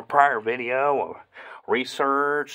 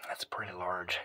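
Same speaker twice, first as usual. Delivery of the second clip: soft awed whisper; 5 semitones lower, 10 dB quieter than usual. Real, not acted. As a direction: whisper it in awe. Whispering at a find.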